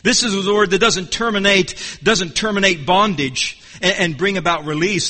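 usual speaking voice

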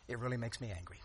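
said through a smile; smiling. Real, not acted. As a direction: smile while saying it. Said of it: "But I'm smiling as I say that".

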